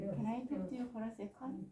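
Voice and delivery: ordinary talk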